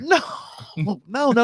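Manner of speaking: high protest; insist, flustered; heated denial. Real, not acted. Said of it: "Look at him".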